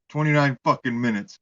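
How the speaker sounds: normal talking voice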